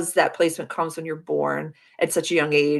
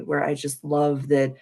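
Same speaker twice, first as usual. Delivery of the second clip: ordinary delivery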